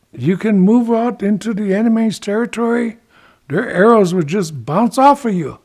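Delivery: high-pitched, persuasive boasting on his behalf; bragging up. Acted. Ichiko sells Turtle on his armor, bragging him up. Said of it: "he's really bragging up that turtle".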